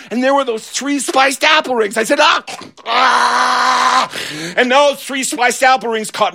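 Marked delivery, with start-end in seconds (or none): 2.2-4.1 high excited ah; delighted gasp. Retold or imitated. Kid seeing his spiced apple rings, high delighted gasp.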